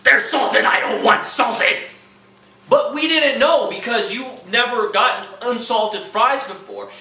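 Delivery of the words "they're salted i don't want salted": mock flip out. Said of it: "You'll flip out".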